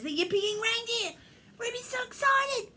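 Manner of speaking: high, loud, thrilled narration; rising to a high-pitched cheer; excited squeal. A narrator's reading. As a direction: squeal with delight for the reindeer. Storyteller shares Ruby's excitement.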